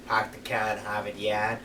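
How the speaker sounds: mock Boston accent with dropped R's. Shown as a demonstration. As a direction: imitate the stock Boston accent phrase. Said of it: "Boston is like".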